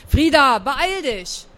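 loud first word, mildly cross; slightly angry call. A narrator's reading. Aunt hurries her dawdling niece, slightly angry. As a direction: hurry the child along with a touch of anger. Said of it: "klang jetzt ein bisschen wütend".

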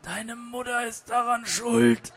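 put-on slow, strained sickly old joke; coughing invalid. Dying mother joke accuses from his wheelchair, coughing. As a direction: cough it out feebly, drawing out the words. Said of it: "hüstelte der Deine Mutterwitz aus seinem Rollstuhl".

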